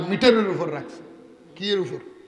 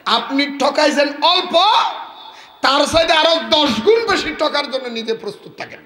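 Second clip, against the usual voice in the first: preaching at full force; 7 semitones higher, 4 dB louder than usual. Acted. Preacher warning the congregation, fiery preaching. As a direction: hammer the lesson home to the crowd. Preacher drives home moral.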